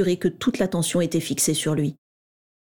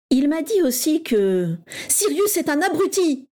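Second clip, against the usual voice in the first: near-tearful outburst; 8 semitones higher than usual. A narrator's reading. Régulus snapping back at James, near tears. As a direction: spit it out, voice close to breaking. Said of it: "explosa Régulus au bord des larmes".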